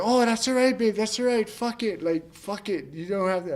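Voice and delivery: wife comforting her crying husband; wife consoling. Retold or imitated. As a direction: soothe him over the phone. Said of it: "she's like".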